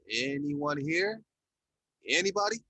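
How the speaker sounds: slow, drawn-out hesitant call. A narrator's reading. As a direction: call out unsure. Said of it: "David said in a hesitant tone".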